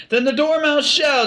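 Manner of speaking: high, slow excited cry. A narrator's reading. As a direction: cry it out eagerly together. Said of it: "they both cried".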